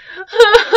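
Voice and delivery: a high burst of laughter; real laugh. Real, not acted. Teacher laughs while reading the story aloud, high burst. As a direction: break into laughter mid-story.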